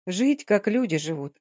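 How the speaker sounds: usual speaking voice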